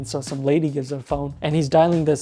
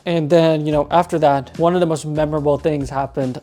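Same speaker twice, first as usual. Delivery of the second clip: usual speaking voice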